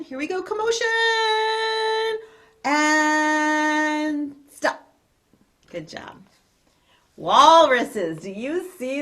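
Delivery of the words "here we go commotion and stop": high call, then a long held "and stop"; excited call. Real, not acted. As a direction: lead the class in a noisy game. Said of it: "make a commotion".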